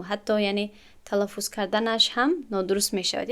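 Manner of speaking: normal talking voice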